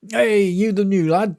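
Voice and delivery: lads calling out. Retold or imitated. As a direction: hail the new boy across the dorm. Lads greet the newcomer.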